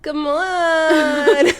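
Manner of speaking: hammed-up, laughing come-on; mock pleading call. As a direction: stretch ON into a playful wail. Jokey drawn-out call, then laughter.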